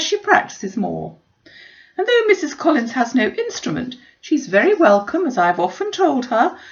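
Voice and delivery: put-on high, imperious old aristocrat lecturing; haughty Lady Catherine. A narrator's reading. Lady Catherine lecturing her guests, high and imperious. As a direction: lay down the law like a grand lady.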